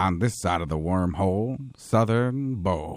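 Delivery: a low documentary intro, slow; Morgan Freeman. Retold or imitated. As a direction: Through the Wormhole narrator voice. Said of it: "Through the Wormhole with Morgan Freeman".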